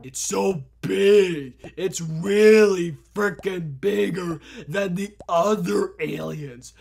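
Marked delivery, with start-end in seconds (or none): none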